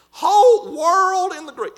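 slow, loud, high drawn-out preacher reading; said real slow. Retold or imitated. Brother Sammy spelling it out slowly and loudly for the girls. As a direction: stretch every word out for the girls. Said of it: "I'm gonna say it real slow".

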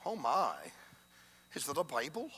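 prim educated lady. Retold or imitated. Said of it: "she spoke like that".

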